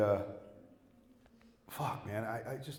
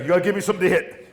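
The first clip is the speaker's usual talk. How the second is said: a hint of Rocky, louder and fast; playful boxer taunt; lightly voiced Rocky; 7 semitones higher, 8 dB louder, faster than usual. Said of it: "he's like".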